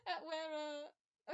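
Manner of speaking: breaking into laughter mid-sentence; laughing. Real, not acted. Game master cracking up while describing the scene, laughing. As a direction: lose it and laugh through the words.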